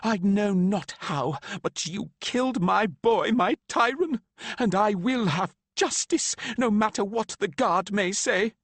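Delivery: high, loud furious accusation; grieving mother swearing justice for her son. A narrator's reading. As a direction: demand justice in fury.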